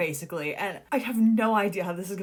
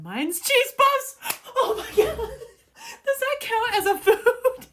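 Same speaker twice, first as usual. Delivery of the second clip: higher, louder, laughing through it; 13 semitones higher, 6 dB louder than usual. Real, not acted. Reader cracking up at his cheese puffs answer.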